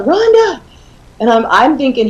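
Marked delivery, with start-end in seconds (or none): 0.0-0.6 calling the name out high, mock yell; retold yell. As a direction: yell for your wife from the porch. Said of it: "And he's yelling".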